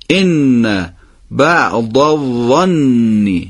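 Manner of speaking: very slow, ان and الظن with doubled نون; slow recitation. Shown as a demonstration. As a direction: hold both شدّه in ان بعض الظن. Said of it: "هر دوتا حرکت و دقتی که رو تشدید". (آهسته با دقت روی دو تشدید)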